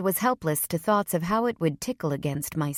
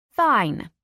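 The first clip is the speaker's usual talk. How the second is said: defiant snap; 5 semitones higher, 5 dB louder than usual. A narrator's reading. Eveline snaps back.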